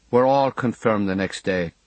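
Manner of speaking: ordinary delivery, nothing marked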